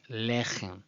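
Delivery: lechem as LEH-khem; correct first-syllable stress. Shown as a demonstration. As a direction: lean on the LE of lechem. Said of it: "Rather, it's".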